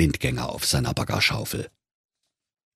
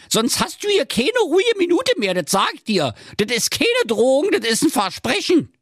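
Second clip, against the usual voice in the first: very high bossy nag; brash Berlin wife laying down the law; threatening wife. Acted. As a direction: threaten the husband in a high scolding voice.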